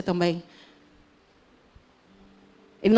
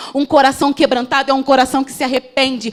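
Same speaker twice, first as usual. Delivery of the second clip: higher, loud, urgent sermon voice; fervent preaching; 5 semitones higher, 5 dB louder than usual. Acted. Preacher urging the church that God wants the heart, fervent and loud. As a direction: press the point home to the church.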